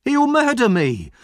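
high, loud cry of panic; panicked high shriek; terrified scream. Acted. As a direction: scream it as if being attacked. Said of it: "screamed Noah".

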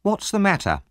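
high-pitched woman's question; concerned onlooker. A narrator's reading. Onlooker asks about the commotion.

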